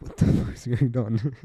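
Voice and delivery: ordinary delivery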